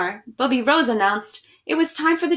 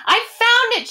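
much higher and louder triumphant cry; shout; 12 semitones higher, 5 dB louder than usual. Acted. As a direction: yell it like a kid who just found the prize. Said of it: "she shouted".

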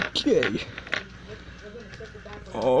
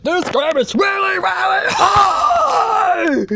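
frustrated shout; 5 semitones higher, 4 dB louder than usual. Real, not acted. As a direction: shout that swells into a long wail. Gamer raging after failing.

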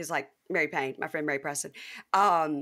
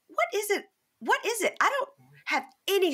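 very high, incredulous repeated question; baffled; 14 semitones higher than usual. Retold or imitated. Asking her sister why Southern stories are so wild, very high and baffled. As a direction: plead for an explanation.

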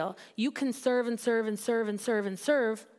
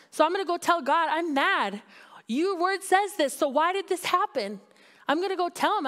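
raised, higher voice telling God off; mock angry prayer; 6 semitones higher, 4 dB louder than usual. Preacher voicing an angry prayer to God, loud and higher. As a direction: let the hurt burst out at God.

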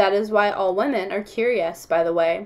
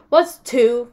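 insistent; 3 semitones higher, 8 dB louder than usual. Acted. Kids quarrel in class.

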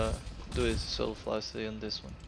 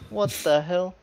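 higher, louder startled cry; surprised outburst; 6 semitones higher, 8 dB louder, slower than usual. Real, not acted. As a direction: blurt it out in shock. Surprised in game.

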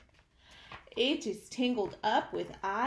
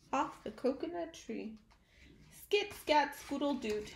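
ordinary delivery

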